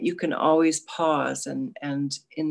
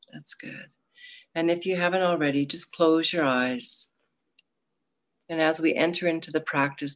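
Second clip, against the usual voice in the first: guided meditation. Acted.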